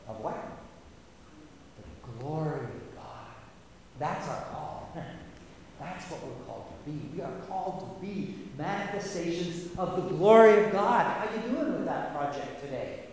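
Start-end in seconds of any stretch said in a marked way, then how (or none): none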